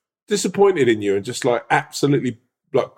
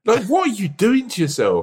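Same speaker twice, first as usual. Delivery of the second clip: exasperated; 9 semitones higher than usual. Real, not acted. Mock scolding over overeating.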